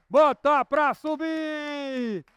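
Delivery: high, slow stretched chant with Bota stressed; roller coaster climb. Pretend. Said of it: "é só fazer o que eu faço".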